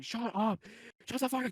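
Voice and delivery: high-pitched mock yell; mock scream. Older sibling telling off a younger one, mock screaming. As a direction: act out screaming at kids, pitch jumping up. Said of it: "just scream at them saying".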